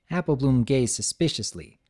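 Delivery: ordinary delivery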